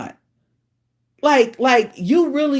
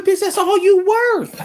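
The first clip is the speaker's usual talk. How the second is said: mock retort; 12 semitones higher, 4 dB louder than usual. Retorting to imagined woman.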